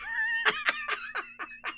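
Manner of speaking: high, squeaky fading laugh; real uncontrolled laughter; helpless laughing fit. Real, not acted. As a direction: crack up and wheeze it out. Cracks up at own line.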